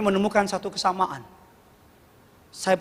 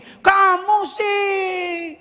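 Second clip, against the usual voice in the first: high, slow drawn-out blame; blaming child; 13 semitones higher, much slower than usual. Retold or imitated. Father blaming his hurt son, high slow scolding. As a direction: scold him for his own fall. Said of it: "Saya nggak bilang gini".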